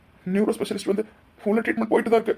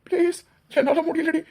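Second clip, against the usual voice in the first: much higher, tearful pleading with breaking sobs; crying plea; 6 semitones higher than usual. Acted. Husband crying out for his vanished wife, sobbing. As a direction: beg her to come back, voice cracking with tears.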